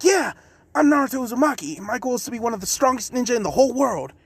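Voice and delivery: high, fast eager boast; excited Naruto. Acted. Naruto boldly telling A his goal, high and fast. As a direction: rush out the big dream. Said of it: "Naruto's just like".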